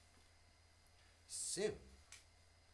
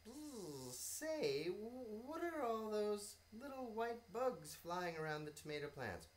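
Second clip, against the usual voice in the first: higher, curious bee with a buzz; 12 semitones higher, 5 dB louder than usual. Acted. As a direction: start with a buzz, wondering. Bee character in story.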